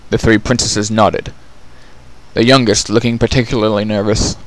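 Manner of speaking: ordinary delivery, nothing marked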